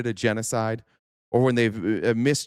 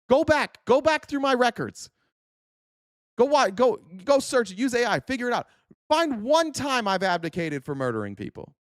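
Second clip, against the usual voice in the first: angry shouting; 8 semitones higher than usual. Real, not acted. Ranting at chat commenters, furious high shout. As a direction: yell the challenge, fed up. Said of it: "You're goddamn right I'm mad".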